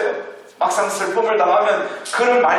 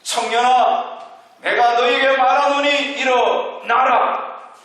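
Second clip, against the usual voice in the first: slow, raised commanding voice of Jesus; a solemn, slow command, pitched a little higher; 6 semitones higher, slower than usual. Acted. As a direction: call the dead youth to rise with slow authority. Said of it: "이렇게 명령합니다".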